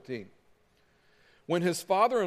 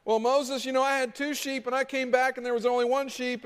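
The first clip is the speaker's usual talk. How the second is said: high-pitched rambling petitioner; complaining villager; 6 semitones higher than usual. Acted. Villager telling Moses about missing sheep, high complaint. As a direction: lay out a petty grievance, pitched up.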